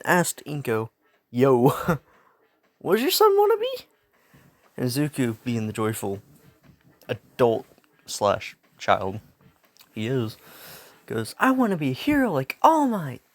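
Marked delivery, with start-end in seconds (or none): 1.3-3.9 much higher and louder, fast, giddy doctor voice; quirk doctor's question. Acted. Doctor asks Inko about her son, high giddy voice. 11.4-13.2 put-on higher, louder eager kid voice; light eager child voice; little boy's dream. Izuku tells the doctor his dream in a lightly voiced eager kid voice.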